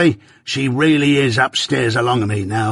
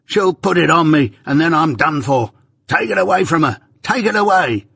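higher and higher, begging in terror; panicked pleading; 6 semitones higher, 3 dB louder than usual. Acted. Raving Arthur begs them to take the shroud away, panicked. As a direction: plead desperately for it to be taken away. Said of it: "Next he cries".

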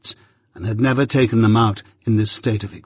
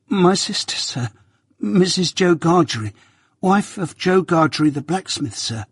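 put-on frightened boy; 6 semitones higher than usual.

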